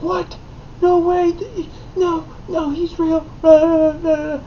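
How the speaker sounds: high, wailing kindergartner protesting; imitating a crying little girl. Little girl insists Santa is real, high and tearful. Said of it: "the girl was like".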